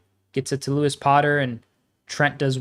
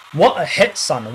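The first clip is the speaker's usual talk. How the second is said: excited; 4 dB louder than usual. Real, not acted. Goal repeated.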